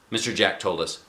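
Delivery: ordinary delivery, nothing marked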